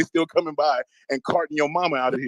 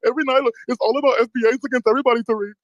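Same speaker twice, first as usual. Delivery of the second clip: high, mock-pleading whiner; pitched-up mock complaint; whining mockery; 6 semitones higher than usual. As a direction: mimic a caller griping to the host. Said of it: "Man, stop whining".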